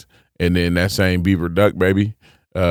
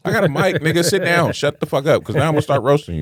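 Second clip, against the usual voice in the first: mock heckler put-down; 7 semitones higher than usual. Comic addressing heckler.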